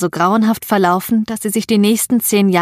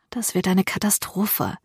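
muttering to herself; 7 dB quieter than usual. A narrator's reading. Gillian to herself approaching the restaurant, muttering. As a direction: mutter it softly under your breath. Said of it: "murmelte Gillian vor sich hin".

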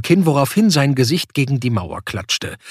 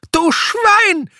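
very high, loud, furious dwarf yell; bellowing dwarf; 4 dB louder than usual. A narrator's reading. Dwarf yelling at Sebastian in high furious rage. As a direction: scream the insult at him. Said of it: "brüllte der Zwerg".

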